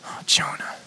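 soft, breathy sigh of a name; tender whisper. Acted. God sighing over stubborn Jonah, whispered. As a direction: whisper his name in sorrow.

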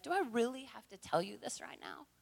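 soft, tired sigh of a question; quietly weary. Pretend. Weary Jesus, head shaking.

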